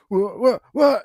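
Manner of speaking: high loud stammering gasp; startled stammer. Retold or imitated. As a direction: panicky cut-off whas. Kayak tipping over.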